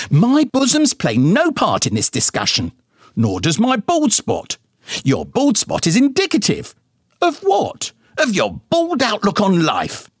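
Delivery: high-pitched, huffy mother voice; indignant mama. A narrator's reading. Mama bickering with daddy about his bald spot, high and huffy.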